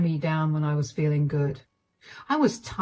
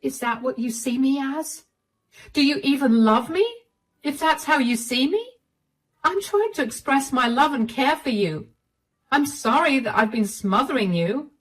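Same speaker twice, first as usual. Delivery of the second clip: higher, wounded wife voice; hurt wife; 7 semitones higher than usual. Acted. Wife asking Clive if he loves her, higher and hurt. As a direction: ask as if the words stung.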